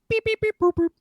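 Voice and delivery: high squeaky beep boop; beeping keypad noises. Retold or imitated. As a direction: chirp the keypad tones. Guard typing keypad tones.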